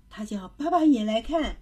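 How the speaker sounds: usual speaking voice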